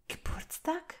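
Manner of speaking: a hushed, startled question to oneself; puzzled realisation. A narrator's reading. Hermine rereading the letter, puzzled.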